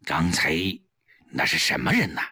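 very low, guarded interrogation; low and watchful, with a pause; wary questioning. A narrator's reading. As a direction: question suspiciously, voice dropped. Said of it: "警惕地问".

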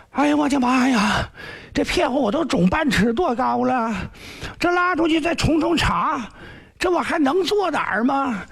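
high, whiny begging youth; whining sick prince. Acted. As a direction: whimper and plead high to escape the beating. Zhang Fang begs for mercy.

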